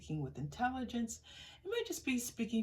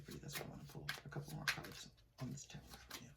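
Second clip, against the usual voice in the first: quick low aside; 8 semitones lower, 13 dB quieter, much faster than usual. Real, not acted. Rushed aside while pulling cards.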